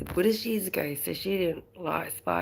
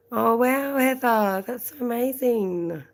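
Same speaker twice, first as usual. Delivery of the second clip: excited gushing; 7 semitones higher, 5 dB louder, slower than usual. Real, not acted. Gushing reply to viewer comment.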